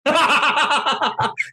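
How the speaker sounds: high laughing burst; real laugh at joke; burst of laughter. Real, not acted.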